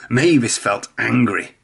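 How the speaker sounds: usual speaking voice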